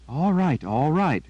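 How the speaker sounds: complaining. A narrator's reading. Girl giving in to her impatient raven, mild complaint. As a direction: give in with a light grumble. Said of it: "she complained".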